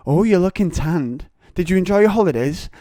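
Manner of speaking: high, loud mock teacher gush. Teacher gushing at Stacey about her tan, loud and high. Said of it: "and then she goes".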